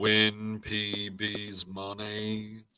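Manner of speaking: very slow monotone game-show host; Ben Stein. Acted. Host teasing a contestant about his prize money, Ben Stein impression. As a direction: drag it out in a level deadpan. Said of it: "you sound like Ben Stein".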